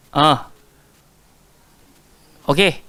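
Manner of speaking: usual speaking voice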